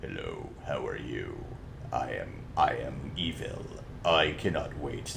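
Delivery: very low, deep, hammy evil villain; deep hammy villain voice reading an audiobook; bad audiobook villain voice. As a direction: growl it out like a cartoon bad guy. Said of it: "the bad guy literally talks like this".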